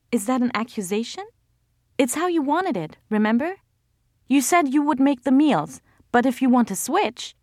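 high, biting comeback; sharp retort. A narrator's reading. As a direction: snap back defensively.

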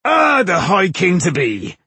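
loud, high, hearty greeting; boisterous hail. A narrator's reading. Mason toasts Tungdil in the tavern, loud and boisterous. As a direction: hail him across a noisy tavern.

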